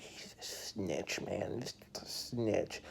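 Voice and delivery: whispered, slow grumble; whispering. Retold or imitated. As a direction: hiss it so the coach won't hear. Bitter remark behind coach's back.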